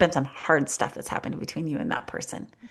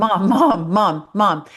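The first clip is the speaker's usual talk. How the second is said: put-on louder, nagging kid calling mom; kid calling insistently; 2 semitones higher, 7 dB louder, slower than usual. Little kid calling for busy mom, insistent. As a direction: tug at mom with your voice, over and over. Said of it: "they keep saying it".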